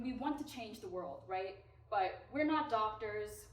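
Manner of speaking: normal talking voice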